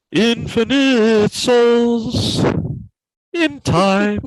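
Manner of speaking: high, loud, drawn-out title; booming announcer. Acted. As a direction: announce it like a horror trailer.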